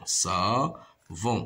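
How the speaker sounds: "savon" in two drawn-out beats; syllable by syllable. Shown as a demonstration. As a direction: sound out "savon" as sa, pause, von. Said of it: "on a deux syllabes".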